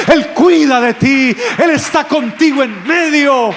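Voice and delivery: rally the whole church, climbing each time; preaching at full force. Real, not acted. Pastor urges every listener not to be afraid, preaching at full force.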